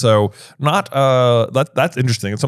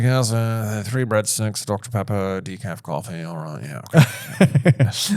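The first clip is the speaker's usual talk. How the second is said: bored waiter; 4 dB quieter, slower than usual. Acted. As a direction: mumble the order away. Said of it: "It's like".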